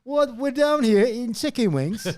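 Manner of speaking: high, loud put-on British voice; mock British accent. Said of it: "a Southern and British accent".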